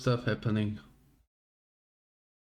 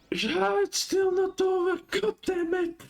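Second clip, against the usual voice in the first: much higher, exasperated whine; high frustrated whine; slower than usual. Real, not acted. Stream still running, frustrated.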